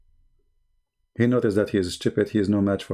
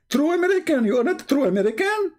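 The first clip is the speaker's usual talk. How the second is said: mocking imitation. Mimicking the debater's boast.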